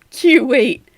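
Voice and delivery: loud, high Q wait, KYOO-wait for Kuwait; mock a learner punching the Q in Q wait; exaggerated KYOO in Q wait; misplaced stress. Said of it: "emphasis is always on the wrong syllable".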